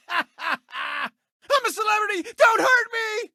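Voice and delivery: high, frightened squeal; panicked yelp. Acted. Host shrieking as something comes at him, panicked. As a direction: cry out in sudden fear. Frightened cry after bragging.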